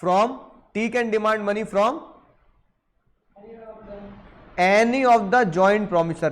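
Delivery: very slow, each word laid out for notes; teacher dictates the court's answer for students to write down, slowly; slow dictation. Shown as a demonstration. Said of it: "तो जज साहब ने क्या बोला".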